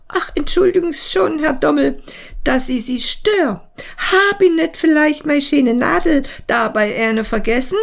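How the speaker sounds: put-on apologetic old caretaker asks about her pin; old caretaker woman. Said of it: "kommt die alte Hausbesorgerin".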